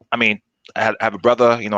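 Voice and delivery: ordinary delivery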